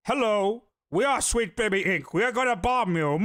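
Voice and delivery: mock villain, capped with an evil laugh; bomb threat caller. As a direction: announce it like a cartoon villain and cackle.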